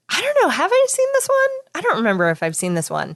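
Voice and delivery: high, puzzled wondering aloud; a touch of mock bewilderment; retold confusion. Retelling her own confusion at the cartoon, mildly puzzled. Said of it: "and I was like".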